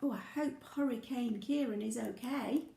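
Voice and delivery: ordinary delivery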